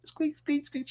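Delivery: tiny, high, soft squeaking; squeaky little voice. Acted. Mocking a protest as background noise, squeaky little voice. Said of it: "Just a squeaky little voice".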